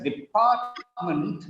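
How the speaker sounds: "Department" drawn out slowly as de-PART-ment; marked word stress. Shown as a demonstration. Teacher telling class to notice stress, slow PART in "Department". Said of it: "notice the stress".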